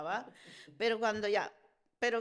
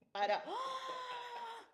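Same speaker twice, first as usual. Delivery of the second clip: much higher, slow drawn-out startled "ah"; startled shriek; 4 dB quieter, much slower than usual. Retold or imitated. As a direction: shriek in shock at the noise. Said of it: "le hace".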